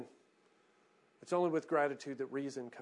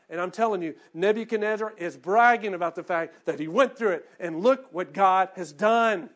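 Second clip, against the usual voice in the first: rising, loud, emphatic sermon voice; preaching at full force; 7 semitones higher, 9 dB louder than usual. Real, not acted. Pastor exhorting the church to brag about blessings, fervent. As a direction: drive it home to the congregation.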